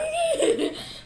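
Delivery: high squealing giggle; shrieking laugh from tickling; ticklish squeal. Real, not acted. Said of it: "Why are you tickling me?".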